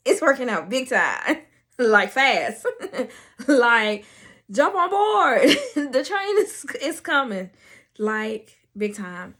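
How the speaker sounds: loud, laughing exclamation; bursting with giddy triumph; elated. Real, not acted. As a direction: shout it out through giggles. Gleeful boast after quiet take.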